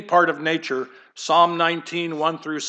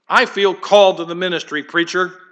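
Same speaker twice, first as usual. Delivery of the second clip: loud, eager mock declaration; acted burst of zeal, projected; excited young convert; 3 semitones higher, 6 dB louder than usual. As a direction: imitate a keen young man volunteering to preach. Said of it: "some young guy who's full of excitement".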